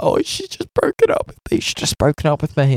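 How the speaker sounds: put-on high, far-off voice of a sobbing girl; mock the upset girl in a squeaky wail; whiny girl quote. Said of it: "and she was like".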